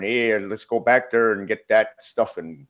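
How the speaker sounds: slow, hammed-up Canadian accent; light mock Canadian accent. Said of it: "I'd be talking just like a Canadian".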